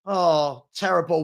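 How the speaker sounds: ordinary talk, nothing marked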